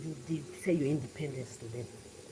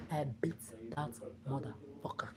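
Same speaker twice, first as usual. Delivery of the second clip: whisper; 2 semitones lower, 6 dB quieter than usual. Retold or imitated. Repeating to followers that she beat him, whispered. Retold confrontation, said twice.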